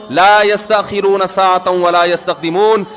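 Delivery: slow, measured Arabic recitation; Quranic recitation. Acted. Preacher reciting a Quran verse to the congregation, slow and intoned. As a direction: intone the verse slowly like tilawat.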